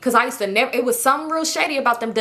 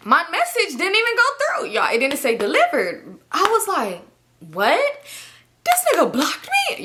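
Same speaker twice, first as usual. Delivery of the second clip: high, stretched shock at being blocked; shocked disbelief; 7 semitones higher than usual. Retold or imitated. Telling friends she got blocked, shocked and high.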